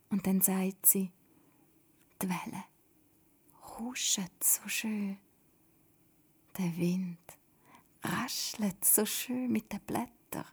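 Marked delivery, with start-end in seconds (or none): 2.2-10.4 dreamy. A narrator's reading. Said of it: "Doda lacht".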